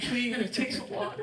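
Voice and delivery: high, slow hammed-up begging; mock crying plea. Waiter begs diner to take water, mock tearful high plea. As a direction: beg like a waiter near tears, jokingly. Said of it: "They start crying".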